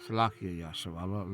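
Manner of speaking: ordinary talk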